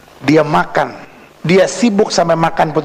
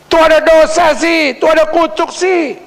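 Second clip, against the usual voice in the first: high-pitched finger-pointing busybody; mock judgmental gossip; 12 semitones higher, 4 dB louder than usual. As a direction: accuse in a high, put-on voice. Voices people judging the troubled. (menirukan orang menghakimi dengan nada tinggi)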